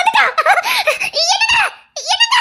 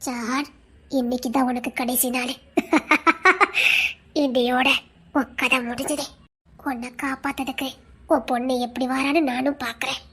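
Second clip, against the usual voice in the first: deep, low demonic growl; deep demonic taunt, far lower than a human voice; 13 semitones lower, 10 dB quieter than usual. Acted. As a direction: menace him like a spirit from below.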